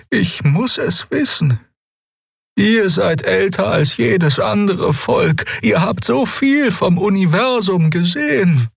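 high, slow, earnest heroine voice; high pleading young woman; Xia, urgent young voice. A narrator's reading. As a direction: press them for the truth, voice lifted high.

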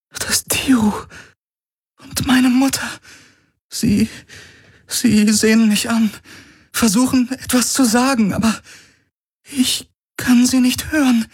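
high, trembling voice near tears; a frightened girl seeing her dead mother; high, close to tears; tearful young woman. Acted.

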